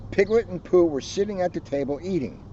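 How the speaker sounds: ordinary delivery, nothing marked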